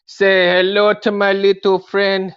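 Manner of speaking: slow, high, loud gangster line; movie quote. Acted. Introducing his toy to viewers, slow and loud. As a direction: boom it out like the film.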